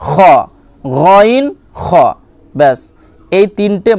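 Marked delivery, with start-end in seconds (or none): 0.8-1.8 গইন খ stretched very slowly from the back of the throat; very slow gain kha. Shown as a demonstration. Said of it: "আখরি হালাক অর্থাৎ শেষ ভাগ হতে". (ধীরে ধীরে গইন খ)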